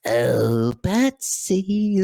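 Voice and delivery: wild manic Joker with cackles. Acted. Voice actor auditioning for Joker, manic cackling Joker. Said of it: "I just go off".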